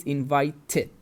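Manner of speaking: "invited" with the last syllable sounded separately as "tid". Shown as a demonstration. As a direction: demonstrate "invited" with a full extra syllable after the t. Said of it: "So the past is".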